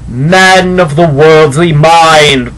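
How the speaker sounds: slow, loud, booming spectral pronouncement; solemn ghost. Acted. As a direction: intone it like a phantom from beyond.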